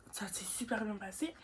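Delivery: soft whispered confession. Real, not acted. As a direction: breathe it out in a whisper. Whispered confession about the date.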